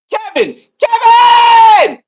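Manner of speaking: drawn-out high shout of Kevin, loud and slow; shouted call. Real, not acted. Fan yelling at coach Kevin to stop lying, long loud shout. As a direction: yell his name across the room like he won't listen.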